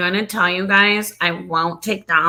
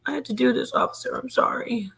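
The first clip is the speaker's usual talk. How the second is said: softer, higher pretend apology; mock pleading confession; 4 semitones higher, 6 dB quieter than usual. Suspect apologizing to the officer, mock pleading.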